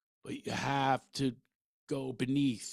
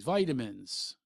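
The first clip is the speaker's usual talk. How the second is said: usual speaking voice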